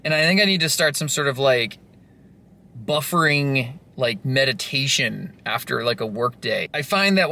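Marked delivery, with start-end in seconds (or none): none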